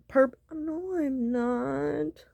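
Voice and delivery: high whiny denial; mimicking denial. Imitating partner's denial.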